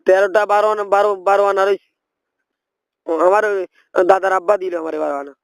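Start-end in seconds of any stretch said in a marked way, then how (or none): none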